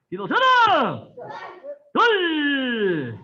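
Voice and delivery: high, loud calls with long falling tails; shouted drill commands. Real, not acted. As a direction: bellow each command, stretching the last vowel. Instructor calls out turn and count.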